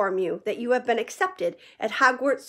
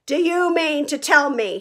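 loud, higher angry growl; 6 semitones higher, 5 dB louder than usual. A narrator's reading. Hagrid growling at the cowering Dursleys. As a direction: growl it at them. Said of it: "he growled at the Dursleys".

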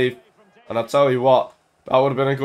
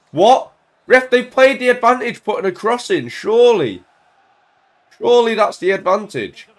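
high, loud disbelief; indignant protest; 8 semitones higher, 5 dB louder than usual. Real, not acted. Gamer disputes the ref's advantage call, indignant. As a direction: protest to the ref in raised pitch.

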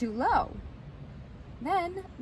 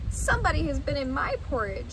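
quick, higher little bear voice; Baby Bear; 5 semitones higher, faster than usual. Acted. Baby Bear finds his porridge gone, quick little bear voice. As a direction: hurry it out like a small bear cub. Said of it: "said Baby Bear".

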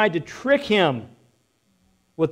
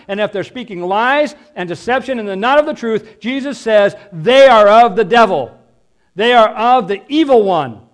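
fervent preaching; 3 semitones higher, 6 dB louder than usual. Acted. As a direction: thunder the warning at the congregation.

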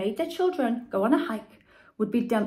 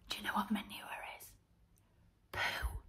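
whisper; 14 dB quieter, faster than usual. A narrator's reading. Narrator's aside to listeners.

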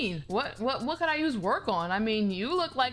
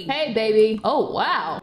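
loud, low swaggering guy voice; cocky boyfriend; 6 semitones lower, 7 dB louder, slower than usual. Acted. Boyfriend strolling in to greet his girl, loud and cocky. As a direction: greet her like a smooth guy.

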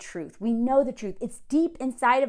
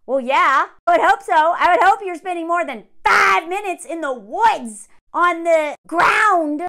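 loud, higher sarcastic jab; sarcastic loud rant; 5 semitones higher, 8 dB louder than usual. Real, not acted. Mocking the couple's cheap hotel affair, loud sarcastic rant. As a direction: scoff it out loud. Reacting to sex getting better.

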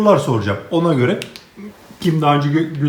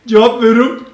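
usual speaking voice

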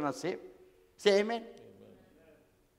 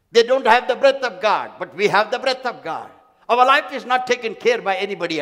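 full-force preaching; 3 semitones higher, 6 dB louder than usual. Acted. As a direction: proclaim it to the congregation.

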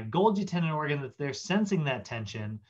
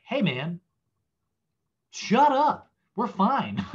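much higher, louder "shut up"; exasperated shout; 7 semitones higher, 5 dB louder, slower than usual. Acted. Tendon organ telling the spindle to shut up, exasperated shout. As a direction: snap at a pal to calm down.

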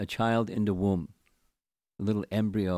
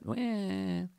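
high mock newborn wail; imitating a baby's first cry; baby cry imitation; 11 semitones higher than usual. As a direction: squeal like a crying baby. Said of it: "you hear the little baby cry".

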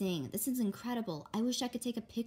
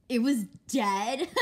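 loud, slow, laughing question; laughing disbelief; 3 semitones higher, 9 dB louder, slower than usual. Acted. Sister mocks her about the dead snake, laughing. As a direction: scoff through a laugh.

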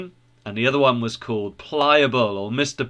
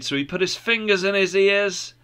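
plain reading voice

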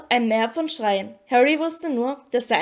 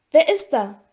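moderately raised voice, demanding; calling out; 3 semitones higher, 5 dB louder than usual. A narrator's reading. Harry calls to someone standing in the dark, calling out. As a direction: call to someone hidden in the dark. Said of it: "rief er".

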